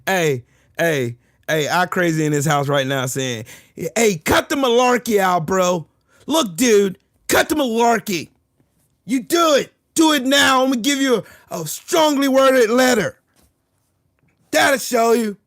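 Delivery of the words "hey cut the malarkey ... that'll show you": high, loud mock tough guy; mock a Canadian issuing feeble threats; hammed-up high-pitched bluster; Canadian acting tough. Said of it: "Canadian acting tough".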